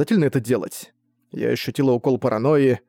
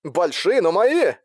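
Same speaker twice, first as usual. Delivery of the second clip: sci fi communicator; 6 semitones higher, slower than usual. Acted. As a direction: voice over a comm link. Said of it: "сказал Крутыш через коммуникатор".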